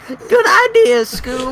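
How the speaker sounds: ordinary talk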